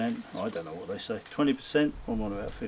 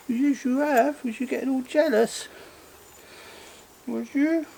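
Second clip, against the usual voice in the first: much higher, teasing pet talk; high baby talk to the cat; 8 semitones higher than usual. Pretend. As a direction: coo at a jealous cat. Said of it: "I should be talking really high pitched".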